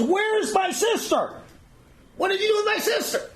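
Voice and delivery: high, strained indignant outburst; angry demand. Retold or imitated. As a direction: act out a frantic brother demanding answers. Brother confronts the Nazi commandant.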